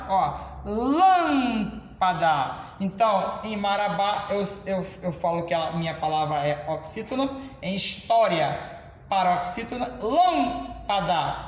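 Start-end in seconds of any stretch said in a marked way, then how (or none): 0.6-2.6 "lâmpada" with "lâm" stretched very long, then "pada"; stress on LÂM. Shown as a demonstration. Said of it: "Talvez não esteja tão claro". 10.0-11.3 "Lâmpada" slowly, first syllable held long; stress on LÂM. Shown as a demonstration. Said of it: "proparoxítona".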